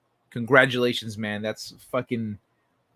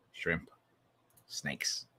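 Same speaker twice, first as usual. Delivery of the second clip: whisper; 10 semitones lower, 14 dB quieter than usual. Pretend. Whispered list aside.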